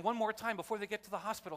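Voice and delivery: ordinary talk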